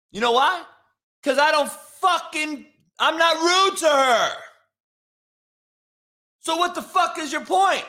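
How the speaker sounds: heated confrontational yelling; indignant retort. Real, not acted. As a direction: fire back angrily, pitch pushed way up. Angry retort to a chatter.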